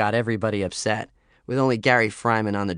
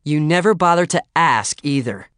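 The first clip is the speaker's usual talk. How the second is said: raised, loud reproach; huffy retort; 6 semitones higher, 5 dB louder than usual. A narrator's reading. Lorraine to Melissa before storming off, huffy retort. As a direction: throw it back accusingly.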